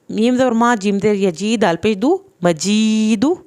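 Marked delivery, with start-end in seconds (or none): none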